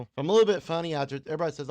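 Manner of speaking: ordinary talk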